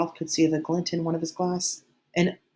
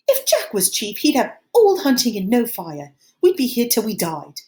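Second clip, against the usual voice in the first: higher, rising toward a squeak; squeaky voice; 7 semitones higher, 6 dB louder than usual. A narrator's reading. Piggy fearing Jack as chief, voice rising high. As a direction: let the panic push the voice up high. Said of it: "His voice ran up to a squeak".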